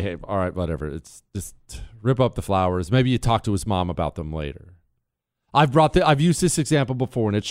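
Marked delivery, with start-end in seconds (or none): 0.0-4.7 resigned giving up. Retold or imitated. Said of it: "you'll probably back off and just say".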